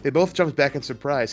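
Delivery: ordinary delivery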